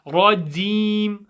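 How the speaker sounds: "rajim" said as "radeem"; dialect D for J. Shown as a demonstration. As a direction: swap the j of "rajim" for a d. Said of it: "So you're not saying rajim".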